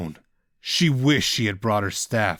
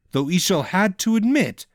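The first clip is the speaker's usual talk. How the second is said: ordinary delivery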